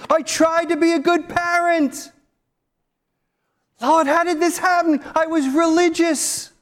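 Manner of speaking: high, pleading wail to God, voice strained near tears; wailing lament. Retold or imitated. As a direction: cry out to God as a lost soul, bewildered and begging. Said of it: "weeping, sobbing, saying".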